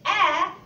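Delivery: A said as short a of apple; short a sound. Shown as a demonstration. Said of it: "It says".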